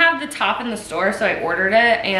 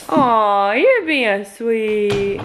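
cooing at pet; much slower than usual. Real, not acted.

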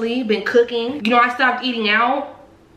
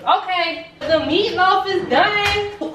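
dramatic reveal; 9 semitones higher, much slower than usual. Pretend.

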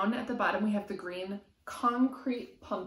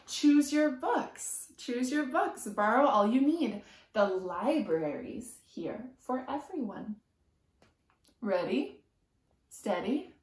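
lively sing-song picture book reading; reading to children; 3 semitones higher, slower than usual. A narrator's reading. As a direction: chant the rhyme to a small child. Reading rhyming picture book aloud.

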